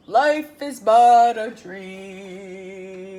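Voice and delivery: very slow, loud croaked line; croaking frogs. Acted. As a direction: croak it together, drawn out. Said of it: "together they croaked".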